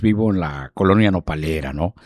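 ordinary delivery

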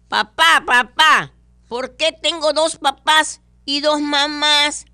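put-on high, loud child calling dad; little boy voice. Pablito asks his father a question, high child voice. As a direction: pitch way up and whine like a small kid.

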